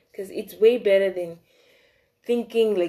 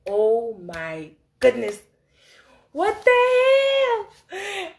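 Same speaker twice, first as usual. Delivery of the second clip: slow, drawn-out disbelief leaping high; stunned, stretched exclamation with pauses; horrified shock; 11 semitones higher, 6 dB louder, slower than usual. Retold or imitated. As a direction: let the horror stretch out, then squeak upward. Acting out shock at sent message.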